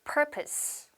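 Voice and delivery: "Purpose" said softly and slowly with a falling end; model pronunciation. Shown as a demonstration. Teacher modelling "Purpose" for students to repeat. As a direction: say "Purpose" clean, stress on the first syllable. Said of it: "따라하세요".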